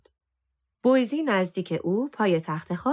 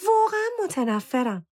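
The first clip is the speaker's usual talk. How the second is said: high, exasperated insistence; emphatic loathing; 9 semitones higher than usual. A narrator's reading. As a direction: insist on it with real disgust. Girl declares hatred of her shoes. (با نفرت و تأکید)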